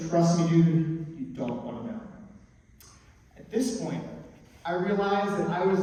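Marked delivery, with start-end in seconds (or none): 0.1-1.9 assuring friend. Retold or imitated. Friend warding off a curious pal, mildly assuring. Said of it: "Carlos assured me".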